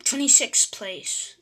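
ordinary talk, nothing marked

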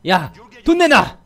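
shouting threat. Pretend. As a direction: yell it like a robber. Robbing shop, threatening owner.

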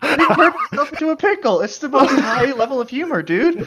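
high laughing glee. Real, not acted. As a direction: giggle through the tease. Gleeful teasing repeat.

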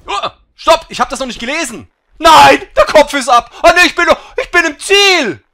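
high, loud frantic yelling; excited shouting. Real, not acted. Panic then crossing finish.

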